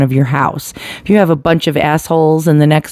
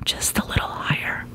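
whispered aside; 15 dB quieter than usual. Real, not acted. Adjusting gain, whispers aside.

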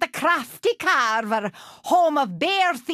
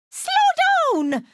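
high-pitched cry after someone hurrying past; calling out. Acted. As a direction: call after them, pitched way up.

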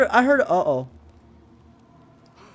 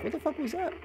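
high, soft baffled question; retold bafflement; 9 semitones higher, 7 dB quieter, faster than usual. Player retells hearing a tripwire, high soft bafflement. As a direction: quote your puzzled reaction in a small, high voice. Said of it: "I was like".

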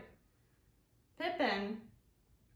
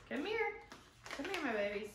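calling a pet; 4 semitones higher, 3 dB quieter, faster than usual. Real, not acted. Calling the cat.

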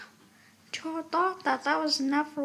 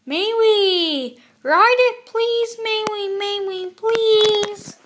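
put-on high, loud, pleading child; whining, stretched-out plea; excited begging; 8 semitones higher, 9 dB louder, slower than usual. A narrator's reading. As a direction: beg loudly like an eager cub. Cubs beg for a ride.

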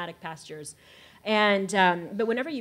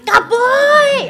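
higher and louder shouted cowboy!, falling at the end; imitated joyful cry of cowboy!; excited shout; 17 dB louder than usual. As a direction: yell cowboy! in delight. Excited Mongolian man, retold.

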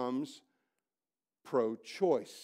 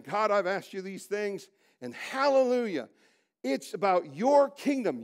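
fervent preaching; 6 semitones higher, 6 dB louder than usual. Acted. Preacher prays the closing of the Lord's Prayer, fervent and loud. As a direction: pray it out at full force.

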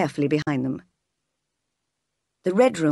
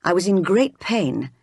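usual speaking voice

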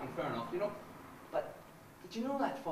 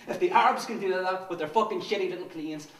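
louder, spitting fury; angry outburst; 10 dB louder than usual. Acted. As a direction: burst out in contempt. Outburst in rant.

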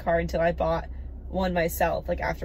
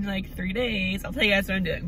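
ordinary talk

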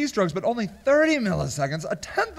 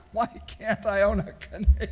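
laughing hard; 4 dB quieter than usual. Real, not acted. Host rereading the Canadian question, laughing hard. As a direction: read it through real laughter.

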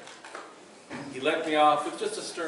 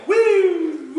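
much higher, louder siren whoop; mock siren; 9 dB louder than usual. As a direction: imitate a police siren wailing behind you. Constable chasing with siren.

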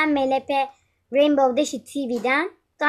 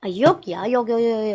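usual speaking voice

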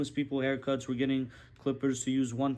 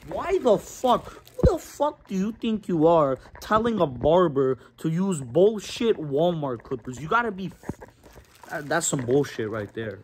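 angry rant; 8 dB louder than usual. Real, not acted. Barber ranting to camera about cheap clippers, loud outrage. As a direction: blow up at the idea of cheap clippers. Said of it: "Had to go on that little rant".

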